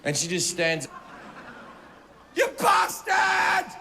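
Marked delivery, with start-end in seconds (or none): 2.3-3.7 high, slow, screamed insult; hysterical shout. Retold or imitated. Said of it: "she's in hysterics".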